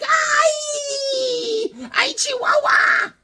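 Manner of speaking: very high shrieking "Ay, Chihuahua"; shrieking cry. Acted. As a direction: scream it like a cartoon victim. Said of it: "She's like".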